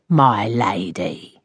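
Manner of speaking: low, slow voice from the tomb; sepulchral. A narrator's reading. Landlady's parting threat to lodger, low sepulchral voice. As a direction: drop it down, slow and ominous. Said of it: "And here it fell, sepulchral".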